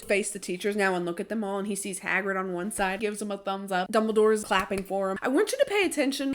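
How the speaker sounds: normal talking voice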